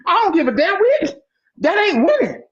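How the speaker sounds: fed-up fan dismissing the playoff runs; indignant outburst. Real, not acted. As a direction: brush it off, fed up. Heated rant about the Cowboys.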